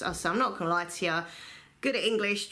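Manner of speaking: ordinary delivery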